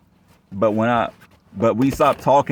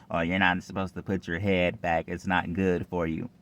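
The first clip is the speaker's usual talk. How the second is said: softer, know-it-all mock voice; 2 semitones lower, 6 dB quieter than usual. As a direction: lightly mimic the ex lecturing, softer. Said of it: "Her ex talking about".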